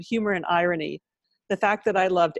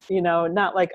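usual speaking voice

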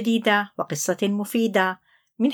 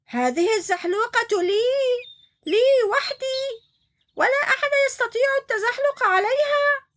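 high, whiny mock-angry claim; angry shout; 14 semitones higher than usual. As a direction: stamp your foot over the slide. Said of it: "صرخ عنتر بغضب". (صراخ غاضب مصطنع)

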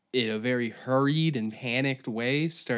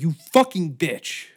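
sudden loud burst of pretend rage; mock angry outburst; 3 semitones higher, 7 dB louder than usual. Father snaps at daughter who objects, mock angry. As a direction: blow up out of nowhere, jokingly.

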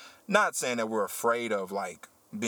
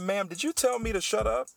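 confronting; 12 semitones higher than usual. Retold or imitated. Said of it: "I said".

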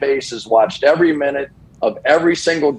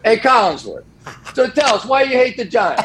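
mock heckling fan; 10 semitones higher than usual. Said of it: "But no".

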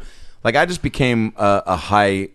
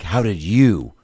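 lower, accusing and suspicious; snide question; 4 semitones lower, much slower than usual. Retold or imitated. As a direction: sneer it as if he doesn't belong there. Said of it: "did you take that as him being like".

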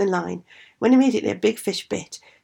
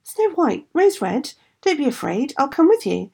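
higher calling out across distance; calling after; 7 semitones higher, 4 dB louder, slower than usual. A narrator's reading. Bear calls after the running girls, calling out. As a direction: call out to the fleeing girls. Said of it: "the bear called after them".

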